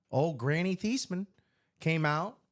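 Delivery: normal talking voice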